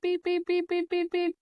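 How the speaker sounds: high, quick mock machine beeps; imitating the heart monitor's alarm beeping; monitor beep imitation. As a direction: chirp it like a hospital alarm.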